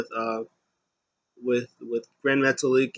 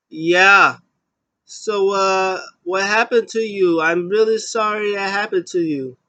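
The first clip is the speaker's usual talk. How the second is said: higher, louder fake concern; mock sympathetic voice; 7 semitones higher, 6 dB louder than usual. Dana Brooke to Sonya Deville, higher mock-sympathetic concern. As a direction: overplay the sweet sympathy.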